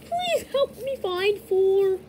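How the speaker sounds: high, slow silly toy voice; toy voice like a dog. Acted. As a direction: drag it out like a pleading toy. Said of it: "bro sounds like dog".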